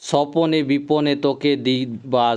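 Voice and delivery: slow, lifted reading of the verse; recited verse, each word stretched; poem recitation. Shown as a demonstration. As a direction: draw out सपने विपने like recited verse. Teacher recites poem line.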